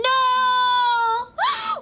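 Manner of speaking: loud high gasp of shock. Real, not acted. Shocked discovery of letter.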